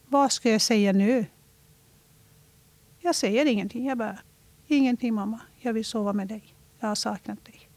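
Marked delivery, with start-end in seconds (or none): 3.0-7.7 very soft, fading into a whisper; hushed reply. Retold or imitated. Dotter svarar mamma på natten. (dämpat, nästan viskande)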